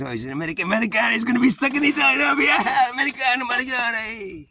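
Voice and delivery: high, fast taunting singsong; mocking Italian kids. Italian kids jeering at the stuck American, high and taunting. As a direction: tease him like jeering schoolkids. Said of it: "all the other Italian kids knew my situation".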